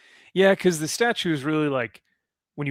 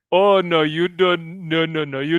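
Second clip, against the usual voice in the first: put-on Indian accent; 4 semitones higher, 4 dB louder than usual. Imitating call center agent.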